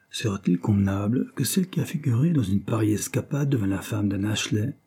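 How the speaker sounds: plain reading voice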